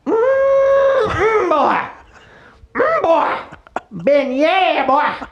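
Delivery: high, drawn-out whooping local; exaggerated high hollering drawl; hammed-up local character. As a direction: howl it like a cartoon riverside local. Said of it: "Oh, you talk like that".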